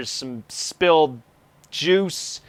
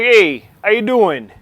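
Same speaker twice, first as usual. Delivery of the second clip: louder, higher, slow New York greeting; New York accent; 6 semitones higher, 6 dB louder than usual. Acted. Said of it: "a New York accent".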